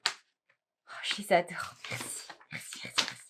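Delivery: barely voiced, very soft thank-yous; hushed thanks. Real, not acted. Crafter thanking the yarn maker for her gift, hushed. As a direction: whisper it, overcome with gratitude.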